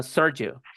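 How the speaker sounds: "Sergio" as American SUR-jo; E swallowed. Shown as a demonstration. Teacher showing American version of his name, E swallowed. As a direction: say "Sergio" with the E eaten, an English UR. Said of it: "Ellos se comen la E".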